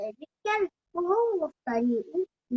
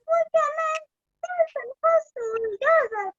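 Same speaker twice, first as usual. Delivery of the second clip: whimpering hungry bunny; 12 semitones higher, 4 dB louder than usual. Acted. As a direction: moan about a sore tummy.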